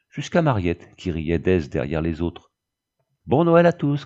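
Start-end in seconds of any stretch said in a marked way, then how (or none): 3.3-4.0 high, loud holiday call; joyful called greeting. Acted. Father calls to his family from the doorway, calling out. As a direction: call it out brightly to everyone. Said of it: "cria-t-il du seuil".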